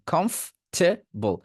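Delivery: Comfortable as "khomf... te... bol", slow and high; slow, in three parts. Shown as a demonstration. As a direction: draw out each part of comfortable. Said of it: "এবার তিনটা একসাথে বলেন". (Comfortable ধীরে, ভাগ করে)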